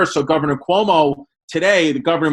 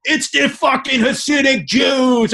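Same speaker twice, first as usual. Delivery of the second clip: slow, much higher hostile shout; imitating a bigoted comment, shouted slowly; mock angry commenter; 9 semitones higher, slower than usual. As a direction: sneer it like an online troll yelling. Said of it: "And all the comments, like".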